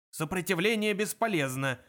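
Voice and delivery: moderately loud military bark; mild roar. A narrator's reading. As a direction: bellow it as a stock army phrase. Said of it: "взревел охранник".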